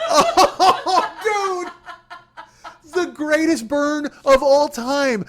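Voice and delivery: high, laughing through the words; cracking up at the memory. Real, not acted. Retelling the greatest burn, laughing hard.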